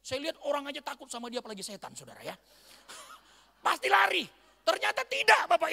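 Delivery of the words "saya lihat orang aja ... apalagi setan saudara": usual speaking voice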